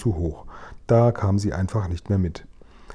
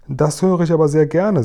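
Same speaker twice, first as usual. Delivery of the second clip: pleased, higher and louder with a smile; smiling; 6 semitones higher, 5 dB louder than usual. A narrator's reading. Queen is pleased to hear the giant is good, smiling. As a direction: sound delighted to hear it. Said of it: "sagte noch immer lächelnd die Königin".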